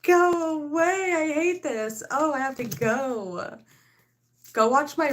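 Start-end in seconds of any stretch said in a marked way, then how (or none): 0.0-3.6 higher, louder, slow mock outburst; hammed-up shout. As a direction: shoo everyone off in exaggerated exasperation. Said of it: "I'm like".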